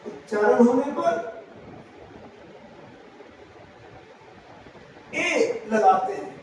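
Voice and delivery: phrase by phrase, long pauses between. Shown as a demonstration. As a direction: dictate the rule so students can write it down. Teacher dictating the next rule.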